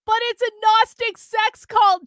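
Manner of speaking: high, strained moan. Acted. James moans at Carlin mid-whipping. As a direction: moan it out in pain. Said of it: "James moaned".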